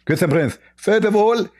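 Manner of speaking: high, lisping caricature; mock a preacher in a high, lispy voice; Zakir Naik. Mock preacher scolding the host about his voice, high and lisping. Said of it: "Zakir Naik, hold on, your voice".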